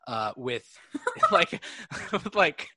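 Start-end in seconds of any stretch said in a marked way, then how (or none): 1.0-2.7 breaking into giggles mid-word; high giggling through the words; laughing mid-sentence. Real, not acted. Laughing at own comment.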